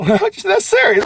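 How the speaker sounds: high, loud and slow through a laugh; amused mock-serious line. Real, not acted. Laughing at own remark.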